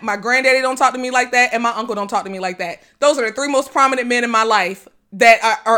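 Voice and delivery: ordinary talk, nothing marked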